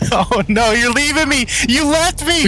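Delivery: high, loud mock wail; mock whine. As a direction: wail as if abandoned. Friend leaves, mock whine.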